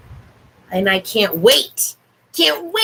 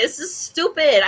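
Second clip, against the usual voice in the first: ordinary talk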